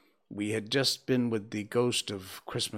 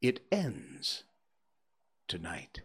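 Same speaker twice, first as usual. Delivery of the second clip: fading into a whisper; unearthly voice. Acted. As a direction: let it die to a hushed whisper. Ghost answers, fading to whisper.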